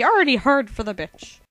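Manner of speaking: ordinary talk, nothing marked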